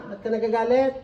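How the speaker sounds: ordinary delivery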